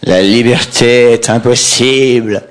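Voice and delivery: lent, solennel; déclame gravement; poète déclamant. Retold or imitated. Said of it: "Le poète". (déclamation lente de poète)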